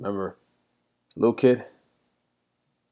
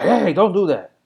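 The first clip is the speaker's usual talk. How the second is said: loud, high sharp reprimand; acted scolding; 10 semitones higher, 9 dB louder than usual. Dad stopping his kid the old way, raised voice. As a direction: re-enact yelling at a kid to stop. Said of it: "Instead of being like".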